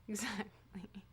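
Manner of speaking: soft whispered echo. Real, not acted. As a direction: whisper it under your breath. Playful echo of her agreement.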